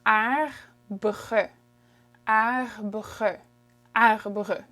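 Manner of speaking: arbre with two French throat Rs, slowly first; both Rs sounded. Shown as a demonstration. Teacher shows the tricky double R, careful arbre. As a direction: sound each R in arbre, ar-bre. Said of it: "There's a double R in there".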